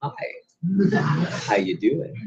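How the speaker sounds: lightly hammed-up come-on with a pause; smooth pick-up line. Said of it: "Maybe not like that".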